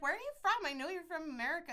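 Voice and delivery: high, loud mock-eager questioning; imitated gushing nurse. Nurse approaching a fellow American, imitated gushing. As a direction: mimic an over-eager fake Californian.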